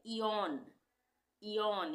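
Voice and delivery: इयॉन said "ee-yon", with a falling tune; pronunciation demo. Shown as a demonstration. Teacher to students, reading इयॉन aloud as "ee-yon". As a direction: model इयॉन for the class as "ee-yon". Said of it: "तो इसको हम क्या पढ़ेंगे?".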